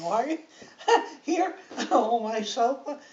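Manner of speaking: loud, laughing teasing. Real, not acted. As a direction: crack up while teasing him. Joking about his soiling himself.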